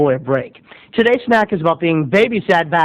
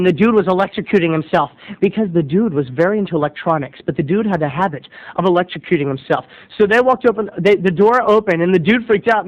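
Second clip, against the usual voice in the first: ordinary delivery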